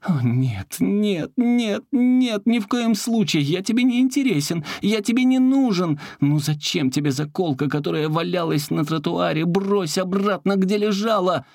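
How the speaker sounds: very high, frantic begging; desperate pleading. Acted. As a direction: beg desperately to be thrown back. Заколка отчаянно просит. (отчаянная высокая мольба)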